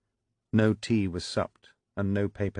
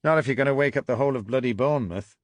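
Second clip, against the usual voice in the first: put-on Lancashire accent; 6 semitones higher, 7 dB louder, faster than usual. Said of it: "an unmistakable Lancashire accent said".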